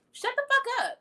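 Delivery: very high hammed-up shriek; mock outburst. Fed up with haters, high mock shriek. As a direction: shriek it in comic outrage. Angry exclamation.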